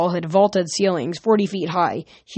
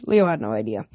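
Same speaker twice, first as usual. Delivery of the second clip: usual speaking voice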